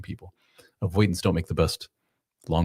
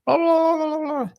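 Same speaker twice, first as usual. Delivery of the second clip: high, squealed gibberish blah-blah; hammed-up emotional dump; mock emotional outburst. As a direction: spew out a parody rant. Acting out a man's emotional outburst.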